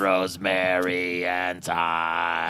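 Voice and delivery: low, very slow robot voice. Pretend. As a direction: drone it out like a robot. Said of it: "Good robot voice".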